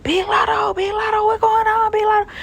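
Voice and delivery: high-pitched mock crowd murmur; excited onlookers. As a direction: squeal it like gawking fans imitating a crowd. Imitates onlookers spotting her at the airport.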